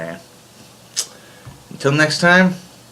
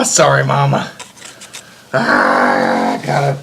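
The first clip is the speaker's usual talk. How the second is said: usual speaking voice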